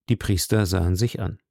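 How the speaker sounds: ordinary delivery, nothing marked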